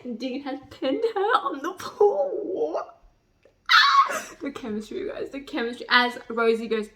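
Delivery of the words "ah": very high, loud laughing squeal; gleeful shriek; excited squeal. Real, not acted. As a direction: shriek with delight. Fangirl squeal.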